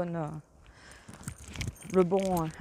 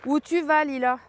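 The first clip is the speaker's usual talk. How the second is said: raised voice calling the dog; 4 semitones higher, 3 dB louder than usual. Real, not acted. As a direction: call after a dog wandering off.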